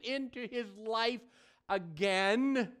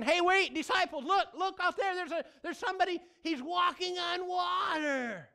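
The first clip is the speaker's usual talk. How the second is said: much higher, amazed, hurried calling out; excited alarm; 8 semitones higher than usual. Retold or imitated. Disciple calls out to the others in the storm, excited. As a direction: point out the figure on the water in wonder. Disciples spot someone on the water.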